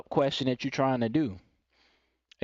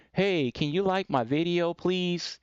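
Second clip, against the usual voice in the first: higher, mock-meek request; put-on pleading, softening at the end; weak, passive plea; 5 semitones higher, slower than usual. Shown as a demonstration. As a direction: imitate a timid YouTuber asking for a like. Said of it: "you can't talk like that".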